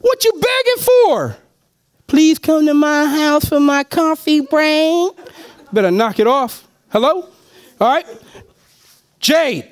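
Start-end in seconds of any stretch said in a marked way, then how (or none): 0.0-1.4 mock retort. Mocking a pleading inviter. 2.1-5.1 mock begging. Mocking a pleading inviter.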